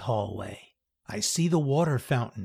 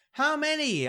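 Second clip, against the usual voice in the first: high eager question; 13 semitones higher than usual. A narrator's reading. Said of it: "laughing as she squeals".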